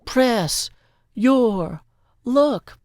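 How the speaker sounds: each word split apart, high and loud; game-show host calling it out; Press, your, luck, each its own loud high punch; dramatic announcer. Acted. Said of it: "press our luck a bit harder".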